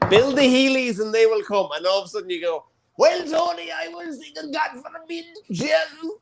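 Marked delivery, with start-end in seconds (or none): 3.0-6.1 high shouted put-on voice, way up in pitch; Healy Ray impression. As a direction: belt it out in a high, showy imitation. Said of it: "It's my Healy Ray impression".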